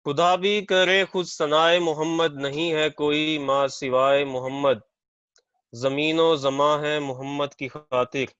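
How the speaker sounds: measured, projected verse delivery; poetry recitation. Real, not acted. Poet reciting a naat at a mushaira, poetry recitation. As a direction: recite the couplet out to an audience.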